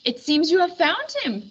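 high king voice with a chuckle; amused king, chuckling. A narrator's reading. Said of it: "chuckled the king".

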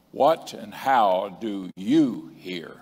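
What and how do you hear? said very slowly, each word weighted, falling at the end; slow, deliberate preacher question; slow emphatic question. Shown as a demonstration. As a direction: lay it out slowly for the congregation. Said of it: "this is an emphasis on each and every one of us".

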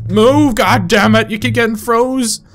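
high, urgent complaint; frantic yelling. Real, not acted. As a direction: yell at the game in a panic. Yelling at game while chased.

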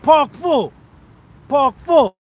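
high, loud shout of two words; loud call. Real, not acted. Showing off to viewers, shouted loud. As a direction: belt it out proudly. Calling out to camera.

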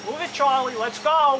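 loud, urgent call; hurrying shout. Real, not acted. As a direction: rush him along, loudly. Hurrying Charlie along loudly.

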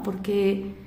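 usual speaking voice

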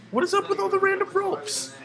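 very high, loud exclamation; shrieked bafflement. Real, not acted. Player meets a mess of random ropes, shrieked bafflement. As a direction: squawk it in disbelief.

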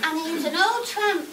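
ordinary delivery

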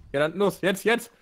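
urging. Real, not acted. Urging partner on.

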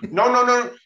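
high, loud volley of noes; retold urgent protest. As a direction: call out urgently to stop him. Retold, trying to stop George.

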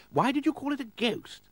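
very high-pitched questioning aristocrat; puzzled lord. Acted. Lord asking why the car's a ghost, high puzzled voice. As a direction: ask it in a high lordly voice.

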